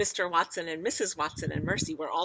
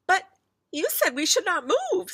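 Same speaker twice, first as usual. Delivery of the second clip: high, loud protest; distressed mother; 13 semitones higher, 8 dB louder than usual. A narrator's reading. Mother argues with father about moving, high distressed voice. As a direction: argue back in a high, upset voice. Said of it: "were arguing".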